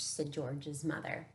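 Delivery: plain reading voice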